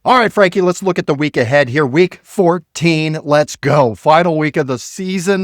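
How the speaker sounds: loud, high hype to open the segment; hyped kickoff. Real, not acted. Host hyping cohost Frankie for week fourteen, excited. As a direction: fire up the show.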